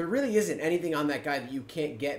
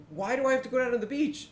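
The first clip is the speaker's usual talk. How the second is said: quick, much higher-pitched mock questioning; retold puzzled question; 11 semitones higher, 4 dB louder, faster than usual. Gamer questioning the Mumble player's tip, puzzled and high-pitched. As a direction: act out your puzzled reply, voice pitched right up. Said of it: "And I'm like".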